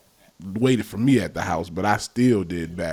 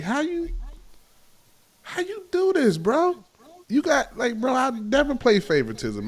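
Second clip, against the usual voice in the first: much higher, exasperated pleading; incredulous. Real, not acted. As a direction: protest in disbelief. Complaining about favoritism.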